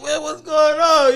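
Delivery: loud, high, drawn-out alarm; high-pitched retold startled shout; startled protest. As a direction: yelp it slowly, acting caught off guard. Acting startled at being hugged.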